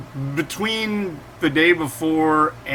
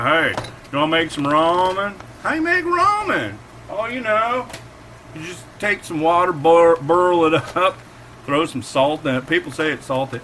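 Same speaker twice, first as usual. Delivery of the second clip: put-on Southern accent; 5 semitones higher, 3 dB louder than usual. Host imitating Southern dudes explaining how to make ramen, Southern accent. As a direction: put on a mock Georgia accent. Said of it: "people who have that accent".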